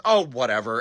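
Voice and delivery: low, soft, dismissive; resigned giving up. Retold or imitated. Mom when her brother falls asleep, resigned. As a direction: drop it low, washing your hands of it.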